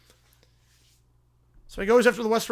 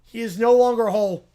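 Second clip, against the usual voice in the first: ordinary delivery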